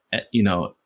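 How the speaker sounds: normal talking voice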